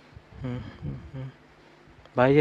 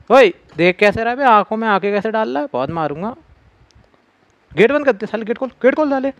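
mock threat; 6 semitones higher than usual. Real, not acted. Threatening the staring portrait.

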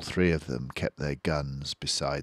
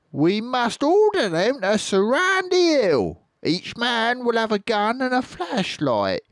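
high scheming farmer; 5 dB louder than usual. Acted. Bean to the farmers, triumphant squeaky plan. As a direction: savour the last words.

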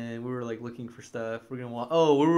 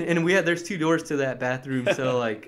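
ordinary talk, nothing marked

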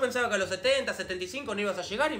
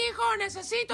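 much higher calling voice; shouting mother; 12 semitones higher than usual. Acted. Mother shouting at her son, high. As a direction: yell across the house as mom.

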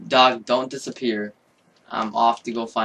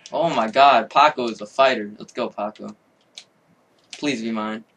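ordinary delivery

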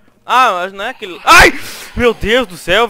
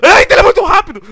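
startled shout; 13 semitones higher, 13 dB louder than usual. Real, not acted. Monster running at him.

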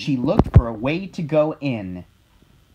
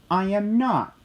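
barked; 7 semitones higher than usual. A narrator's reading. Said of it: "barked the dog".

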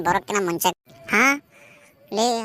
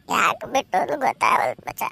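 tearful wail, pitch breaking up and down; acted crying. Jilted lover moans to friends over drinks, crying. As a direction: weep it out to your drinking buddy. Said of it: "ಯಾಕೆ ಅಳಾಕತ್ತೇನ".

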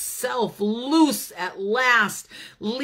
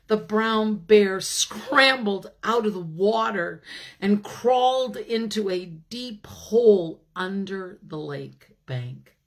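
ordinary delivery

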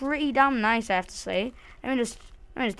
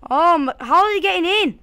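alarmed; 9 semitones higher, 9 dB louder than usual. Real, not acted. Mobs breaking into home.

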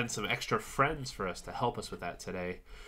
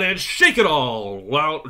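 big loud finish to the shake; 3 semitones higher, 9 dB louder, slower than usual. Shown as a demonstration. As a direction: belt it out to end the warm-up. Warm-up shake, building to loud.

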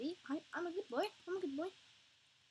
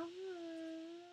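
usual speaking voice

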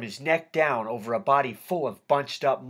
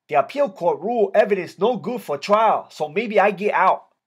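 put-on raised, loud Vietnamese-accented voice; 6 semitones higher, 6 dB louder than usual. A narrator's reading. K nine explaining his retrial to his friend, Vietnamese accent. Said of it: "in a heavy Vietnamese accent".

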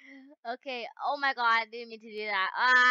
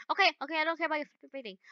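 rapid annoyed rattle; 3 semitones higher, much faster than usual. Real, not acted. Annoyed at passenger rating.

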